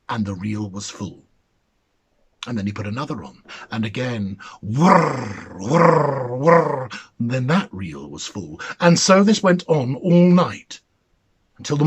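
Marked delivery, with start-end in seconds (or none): 4.6-6.9 lightly voiced whirring wheel. A narrator's reading. Narrator voices the spinning wheel, a whirring wheel. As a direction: hum the wheel turning.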